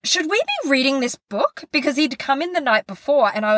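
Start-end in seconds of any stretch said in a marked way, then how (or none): 0.0-1.6 1 husband's doubtful question. Retold or imitated. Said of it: "was like".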